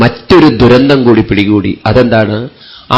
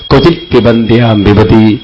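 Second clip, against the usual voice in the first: slow Sanskrit recitation. Shown as a demonstration. Quoting Sanskrit verse from Bhagavatam.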